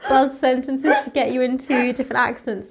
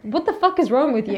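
ordinary delivery

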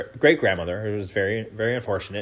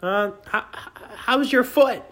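put-on high, timid kid voice; nervous little boy asking; awkward kid greeting; 12 semitones higher, slower than usual. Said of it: "It's like".

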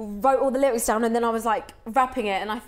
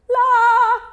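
mock diva trill; 13 semitones higher, 12 dB louder than usual. Mocking the other kids' singing.

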